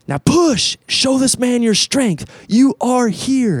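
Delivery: high, slow, drawn-out rallying yell; imitated yelled commands. Retreat leader urges men locking shoulders, imitated yell. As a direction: urge them on like a drill leader.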